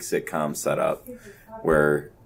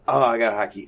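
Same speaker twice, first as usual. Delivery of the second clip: himself making excuses; 5 semitones higher, faster than usual. Retold or imitated.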